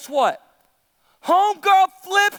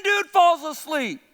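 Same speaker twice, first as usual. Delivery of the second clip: ordinary talk, nothing marked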